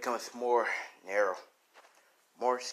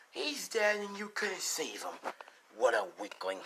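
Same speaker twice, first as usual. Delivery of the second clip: taunting villain; 7 semitones higher, 4 dB quieter than usual. Acted. Villain keeps laughing and taunting.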